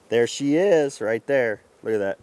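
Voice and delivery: loud, thrilled call; excited discovery. Real, not acted. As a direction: burst out as you finally spot her. Spots the queen bee.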